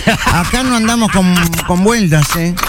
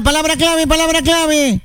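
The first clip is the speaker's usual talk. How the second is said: shouted catchphrase; 6 semitones higher than usual. Shown as a demonstration. Host shows the contestant how to answer, high shouted call. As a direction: sing it out high and hold the ending. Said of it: "y lo tenés que gritar".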